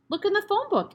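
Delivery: put-on high, helpful boy's voice; eager small boy. Eric answers the headmistress, high and eager. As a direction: pipe up brightly like a child.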